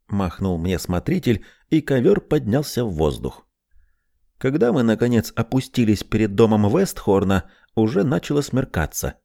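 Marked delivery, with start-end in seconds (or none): none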